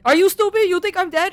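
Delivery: high, loud mock-scolding mother; scolding mom. Mom off the plane scolding her son, high loud mock scold. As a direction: snap it like an exasperated mom. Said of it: "She was just like".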